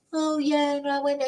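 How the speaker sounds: whining, pitiful tone; whiny voice. Retold or imitated. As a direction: pathetic whiny imitation. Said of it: "in the whiny voice".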